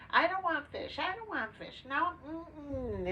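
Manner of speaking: put-on refusing child. A four-year-old refusing the fish his father offers, loud and high. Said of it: "his father was trying to feed him fish".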